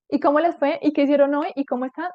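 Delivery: high, loud string of questions; exaggerated inquisitive greeting; mock eager questioning. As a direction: pepper them with questions, playfully. Acting out barrage of questions.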